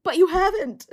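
high, loud mock clapback; sharp retort. Olivia snapping back at Tom in the blowout, high and loud. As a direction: fire it right back at him. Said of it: "And she's like".

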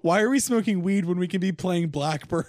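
high, loud mock-earnest appeal to the guys; mock plea. Pleading with friends to drop weed for guitar, mock-earnest. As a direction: laugh through an exaggerated high plea.

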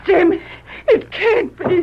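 crying out in disbelief, voice breaking high; a gasp of tearful disbelief; shocked crying. Acted. Wife seeing her lost husband alive, crying out.

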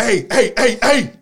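loud, high calling out hey. Retold or imitated. Bartender chasing them down, calling out loudly. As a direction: shout to get their attention.

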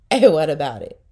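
loud laughing challenge; laughing defiance. Real, not acted. Owner laughing as she defends her dog. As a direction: laugh and dare them.

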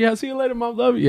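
put-on high-pitched childlike goodbye; little kid. Kid saying bye to mom at drop-off, high and childlike. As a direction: chirp it high like a schoolkid. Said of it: "like a little kid, like in, like in elementary".

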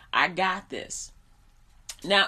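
ordinary talk

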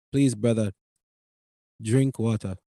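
low imitated Indian-accented plea. Clerk urging his coffee customer, low Indian accent.

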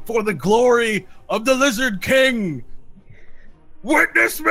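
high, loud, drawn-out war shout; fanatic battle cry. Acted. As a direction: bellow a last glorious cry. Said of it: "you can hear very faintly through the walls".

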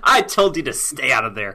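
hammed-up mom scolding the kids; mock scolding mom. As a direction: playfully tell the little ones off. Joins in playing the mom.

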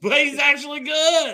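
high, loud laughing shout. Real, not acted. To his co-host about the lineman, a laughing shout. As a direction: burst out laughing.

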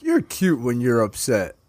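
slow, drawn-out mock deep-voiced flirt. Host retelling a commercial's last line, slow imitation. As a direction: draw the line out slowly in imitation. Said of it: "I got a deeper voice".

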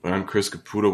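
ordinary delivery

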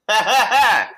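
high, loud put-on laugh; creepy character cackle.

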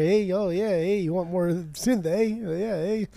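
hammed-up apologetic Canadian, asking nicely; mock polite Canadian. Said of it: "probably apologizing all the time".

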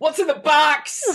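shouted movie line; Se7en quote. Retold or imitated. Co-host quoting a film, loud. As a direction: yell it like the desperate detective. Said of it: "Calm down, Brad Pitt".

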